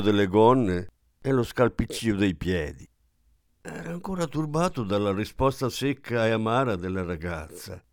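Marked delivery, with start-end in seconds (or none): none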